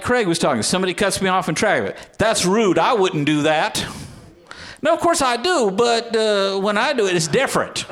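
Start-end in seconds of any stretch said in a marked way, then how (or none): 4.8-7.9 sudden high yelp of self-correction; mock self-correction. Preacher admitting to the congregation he cuts people off, high mock yelp. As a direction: catch yourself mid-boast, jumping up in pitch.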